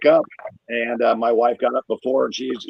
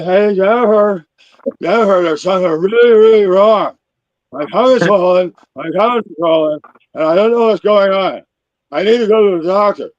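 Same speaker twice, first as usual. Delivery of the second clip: loud, high mock swollen-tongue speech; 7 semitones higher, 7 dB louder than usual. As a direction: talk like your tongue is too big for your mouth. Comedian acting his swollen-tongue call.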